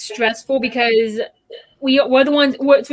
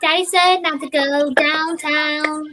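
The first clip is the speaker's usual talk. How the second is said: put-on little girl voice; 3 semitones higher, slower than usual. Shown as a demonstration. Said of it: "I can make it sound like a child's voice".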